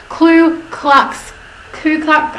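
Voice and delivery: high, fumbling restart on Ku Klux; stuck repeating Ku Klux, unable to get it out; tongue-tied stumble. Real, not acted. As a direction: trip over Ku Klux, pitch jumping up. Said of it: "I can't even say it".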